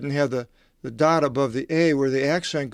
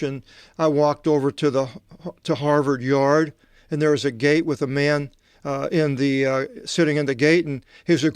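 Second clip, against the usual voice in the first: normal talking voice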